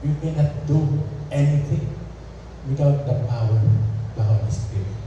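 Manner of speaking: usual speaking voice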